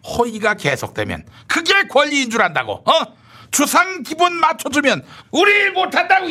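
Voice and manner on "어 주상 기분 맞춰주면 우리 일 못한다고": angry shout. Acted. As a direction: hammer the point home in rage. Continues angry tirade.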